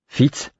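neat, careful Fitz; careful articulation. A narrator's reading. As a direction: articulate Fitz carefully, as to someone who may not understand. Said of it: "articulai-je avec soin".

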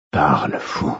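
low rasping command; hoarse voice. A narrator's reading. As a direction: rasp out the order, low. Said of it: "ordonnai-je d'une voix rauque".

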